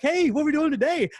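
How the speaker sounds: cheerful dog talking to its owner; happy dog voice. Retold or imitated. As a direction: voice the dog as a bouncy, excited pup. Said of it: "the dog's just like".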